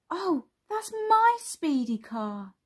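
high, slow, drawn-out objection; high-pitched possessive little kid; protesting child. Acted. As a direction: claim the toy like a small child. Bing sees his toy taken.

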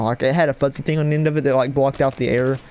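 ordinary delivery

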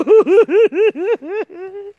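long, high staccato cackle. Real, not acted. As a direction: cackle in a high rippling run. Bursts out laughing after teasing thanks.

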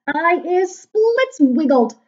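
high, very loud drawn-out yell; yelling giant. A narrator's reading. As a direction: yell it slowly at high pitch. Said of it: "yelled the child chewer".

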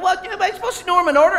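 laughing imitation of people objecting; mocking objector. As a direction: poke fun at the nitpickers. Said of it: "People are like".

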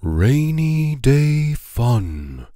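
slow announced chapter title; title read. A narrator's reading. Narrator announcing the chapter slowly.

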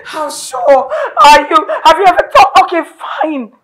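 loud, slowed, sobbing demand; crying shout. Acted. Wife sobbing loudly at husband. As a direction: throw the question back in tears.

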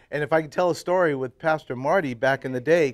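ordinary talk, nothing marked

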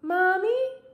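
high, rising little question; tentative child. A narrator's reading. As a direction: check timidly whether she is all right. Boy checks on squashed mother.

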